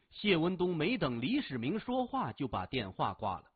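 ordinary delivery

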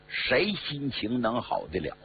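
ordinary delivery, nothing marked

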